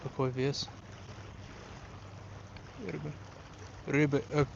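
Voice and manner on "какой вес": usual speaking voice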